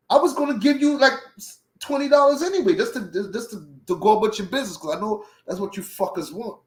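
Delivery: high, loud acted-out protest; indignant argument. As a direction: argue back, high-pitched and aggrieved. Arguing with police taking money.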